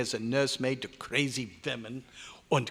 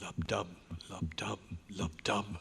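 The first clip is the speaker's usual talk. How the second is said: whispered heartbeat; 6 semitones lower, 9 dB quieter than usual. Acted. Imitating the heartbeat sound.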